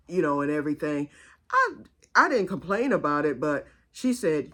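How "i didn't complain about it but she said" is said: plain reading voice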